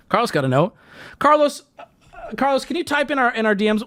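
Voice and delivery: ordinary talk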